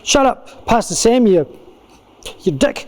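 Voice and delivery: mock-angry retort, high then dropping; acted heated comeback with halting delivery. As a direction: hurl a hurt comeback, half in jest. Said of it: "really ashamed or really angry".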